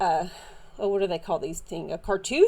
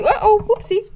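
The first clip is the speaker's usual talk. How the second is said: high, loud startled blurt; real surprised whoop, pitch jumping up; 12 semitones higher, 7 dB louder than usual. Real, not acted. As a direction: react to a sudden little mishap. Tripod falls mid-sentence.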